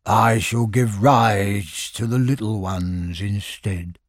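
low, slow, glum donkey drawl; gloomy Eeyore. Acted. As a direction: sound like a gloomy old donkey. Eeyore voiced in story.

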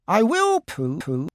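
high little creature voice; Piglet, eager. Acted. Piglet agrees to join Pooh's hum, high and eager. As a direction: pipe up high and willingly.

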